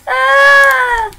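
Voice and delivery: high, loud, long "aah"; frustrated scream. Acted. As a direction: scream at a stuck package. Struggling to open the gift.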